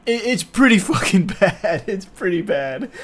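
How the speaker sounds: amused, talking through laughter; real laugh breaking into the words. Real, not acted. Host admits to viewers the movie is bad, laughing.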